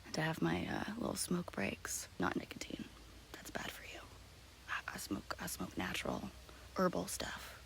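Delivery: soft, hushed half-whisper. Real, not acted. Confiding in viewers from the woods on a smoke break, hushed. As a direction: keep your voice down, nearly whispering. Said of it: "I don't know why I'm whispering".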